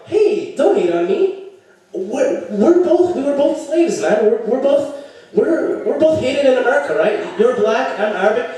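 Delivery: high, stammering mock appeal; nervous pleading. Nervous man pleading with his girlfriend's threatening brother, high stammer.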